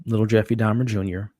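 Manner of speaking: ordinary delivery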